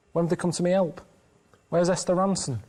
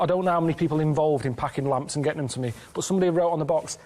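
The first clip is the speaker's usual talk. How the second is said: ordinary delivery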